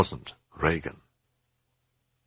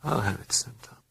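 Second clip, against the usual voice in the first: whispered, tired and faint; weak whisper; 4 semitones higher, 5 dB quieter than usual. Acted. The frightened mother answering the priest in a weak whisper. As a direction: breathe it out with no voice. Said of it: "she said weakly".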